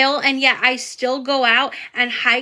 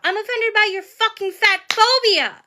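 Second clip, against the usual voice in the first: yelling; 8 semitones higher than usual. Real, not acted. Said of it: "I'm yelling".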